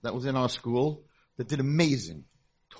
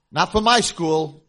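firm refusal; 5 semitones higher, 9 dB louder, slower than usual. Retold or imitated. Seminary head refuses.

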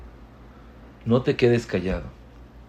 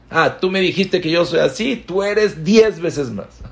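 mock retort; 7 semitones higher, 5 dB louder than usual. Acted retort in an argument.

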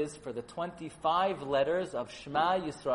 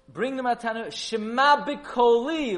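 urgent mother; 8 semitones higher, 9 dB louder, slower than usual. Acted. Rivka urging Yaakov in quote.

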